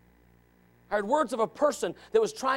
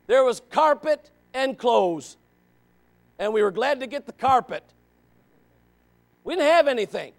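normal talking voice

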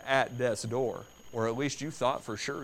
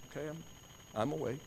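soft, drowsy mumble; just woken, still half asleep; groggy waking; 2 semitones higher, 10 dB quieter, faster than usual. Acted.

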